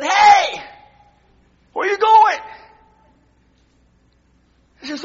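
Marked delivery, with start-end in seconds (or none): 0.0-2.4 loud call after someone leaving, higher; Hey, called out loud to stop her. Retold or imitated. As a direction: shout after her across the church. Preacher calls after departing woman.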